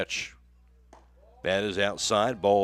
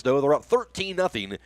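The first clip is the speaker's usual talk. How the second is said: ordinary talk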